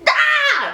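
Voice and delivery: high, loud cracking-up cry; laughing shriek. Real, not acted. Reviewer cracking up at her own joke, high loud shriek.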